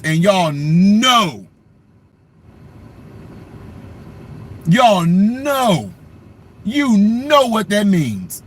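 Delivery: slow knowing drawl. Real, not acted. Knowing emphasis.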